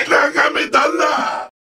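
high, triumphant villain cry; gloating shout. Acted. Villain enjoying the view, gloating high shout. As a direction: crow over the view in battle.

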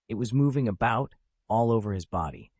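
ordinary delivery

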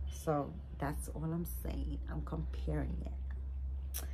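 soft conspiratorial whisper, slowing at the end; secretive whisper. Real, not acted. To viewers, hinting at a secret doll comparison, whispered. Teasing secret about new baby.

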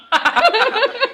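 high delighted squeal of laughter. Real, not acted. Delighted laugh at a friend's dialect. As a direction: shriek with laughter.

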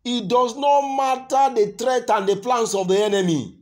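loud, high, drawn-out sermon delivery; full-force preaching. Real, not acted. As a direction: preach it loud, stretching the key words.

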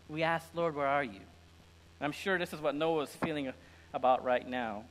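ordinary talk

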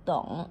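tụng said as Mandarin dǒng with the mouth kept open, no closing; low tụng, mouth not closed at the end. Shown as a demonstration. Teacher shows students to keep the mouth open on tụng. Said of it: "không có được đóng miệng lại nha".

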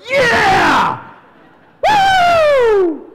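high, full-out ecstatic whoop; cheering shout. Retold or imitated. Pastor reenacting his cheer for the band, ecstatic shout. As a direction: yell it like a fan leaping up in the stands.

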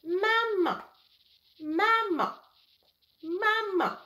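high toy doll calling mama; squeaky high doll, each mama falling; talking doll voice. A narrator's reading. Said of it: "La poupée dit".

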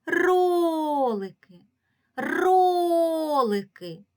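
ролики called high and loud, first syllable stretched long; slow, loud hail with long stressed РО in ролики; calling out the word. Shown as a demonstration. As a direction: call out роооолики, leaning on РО. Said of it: "Погукаємо слово".

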